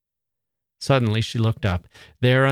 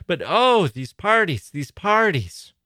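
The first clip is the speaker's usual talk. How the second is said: mock weary lament; 8 semitones higher than usual. Mrs Sheridan's mock lament.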